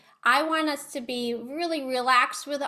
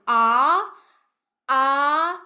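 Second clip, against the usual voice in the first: rising ah steps; 7 dB louder, much slower than usual. Shown as a demonstration. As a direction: climb the pitch in three steps. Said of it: "three steps".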